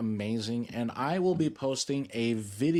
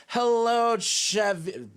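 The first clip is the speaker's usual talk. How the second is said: high, loud burst of hello; loud greeting; 11 semitones higher, 6 dB louder, much slower than usual. Real, not acted. Streamer greeting a chatter by name, loud and high. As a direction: call out hello to a new viewer.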